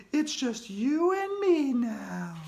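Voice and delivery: high, slow eerie ghostly voice; menacing ghost. Acted. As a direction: drag it out to menace him.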